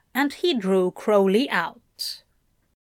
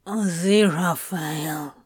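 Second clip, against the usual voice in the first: lower, strained plea; Crowley, exasperated; 4 semitones lower than usual. A narrator's reading. Crowley pleading with Aziraphale, low and strained. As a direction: say his name like a warning.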